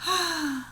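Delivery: a soft, high little sigh; small wistful rabbit sigh. Acted. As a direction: let the rabbit sigh out its longing. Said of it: "The rabbit sighed".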